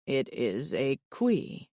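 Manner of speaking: low, even alien voice; steady, low and earnest; calm alien suitor. Acted.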